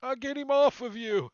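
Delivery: high, loud gruff promise; goblin helper. Acted. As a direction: charge in to help a buddy. NPC shouts helping buddy.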